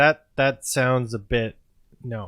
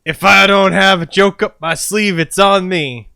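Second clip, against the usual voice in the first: boastful cop voice; 6 semitones higher, 7 dB louder than usual. Acted.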